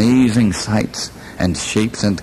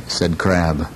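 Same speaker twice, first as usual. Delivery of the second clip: ordinary delivery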